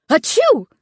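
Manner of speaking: loud sneeze burst; big sneeze. Acted. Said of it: "Floyd sneezed again".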